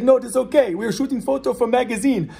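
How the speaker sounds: high, loud imitation of a heavy Portuguese accent. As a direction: put on Dan Borky's heavy Portuguese accent, pleading with police. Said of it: "in his heavy Portuguese accent".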